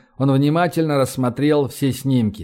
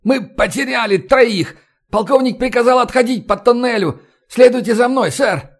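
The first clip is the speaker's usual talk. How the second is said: strained, raised, urgent voice; hoarse croaked report; 9 semitones higher than usual. A narrator's reading. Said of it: "он прохрипел".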